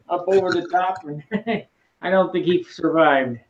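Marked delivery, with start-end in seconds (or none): none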